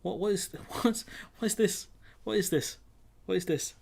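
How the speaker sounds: high disbelieving "what is this"; laughing, can't believe it; incredulous laugh. Real, not acted. Reacting to the absurd story.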